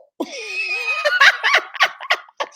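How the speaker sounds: high burst of laughter; shrieking laugh. Real, not acted. Laughing at the story.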